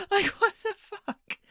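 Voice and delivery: high, drawn-out disbelief; incredulous. Real, not acted. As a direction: stretch it out high, dumbfounded. Incredulous reaction to a debate answer.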